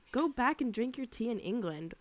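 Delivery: ordinary talk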